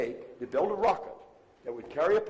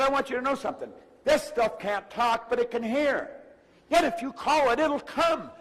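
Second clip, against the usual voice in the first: higher, insistent quoted mentor; emphatic; 8 semitones higher, 4 dB louder than usual. Retold or imitated. Mentor urging his listener that money will come, emphatic. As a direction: press each point home emphatically, pitched up. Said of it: "this guy was very emphatic".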